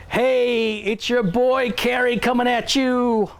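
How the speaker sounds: youthful streamer greeting. Pretend. Streamer greets millennial viewers in a hammed-up influencer voice. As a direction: ham up a Gen Z influencer intro. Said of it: "let me welcome you by saying".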